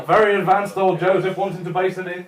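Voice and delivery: ordinary talk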